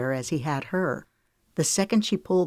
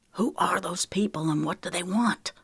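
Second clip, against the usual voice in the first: whispered question; urgent whisper; 2 semitones higher than usual. A narrator's reading. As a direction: keep it hushed, captors close. Whispering with kidnappers nearby.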